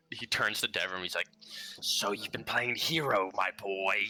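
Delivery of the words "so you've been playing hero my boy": lightly voiced sweet, sinister father; a hint of a sinister sweet spirit. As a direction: coax him with sinister sweetness. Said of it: "a very sweet, kinda sinister tone".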